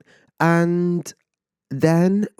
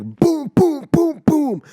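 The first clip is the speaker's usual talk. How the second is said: booming bangs pitched way up, louder each hit; imitated door banging; 4 dB louder, much slower than usual. Retelling the neighbor pounding on their front door, loud booming imitation. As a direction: punch out each boom like a fist on the door. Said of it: "so fucking loud".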